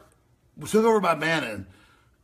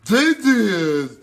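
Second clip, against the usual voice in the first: high, loud, slow mocking repeat; sneering high mimicry of the guest; mocking parrot; 6 semitones higher, 9 dB louder, much slower than usual. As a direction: drawl it back to mock him.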